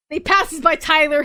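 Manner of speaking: ordinary delivery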